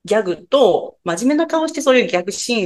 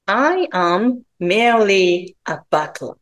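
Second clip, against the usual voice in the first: slow, clear English demo line; slow careful English; much slower than usual. Shown as a demonstration. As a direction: spell it out slowly for learners. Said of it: "これを英語で言うと". (ゆっくり丁寧な英語の手本)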